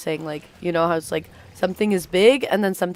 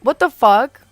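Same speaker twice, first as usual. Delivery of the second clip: shocked outburst; 5 semitones higher, 8 dB louder than usual. Real, not acted. Reacting to dying in game.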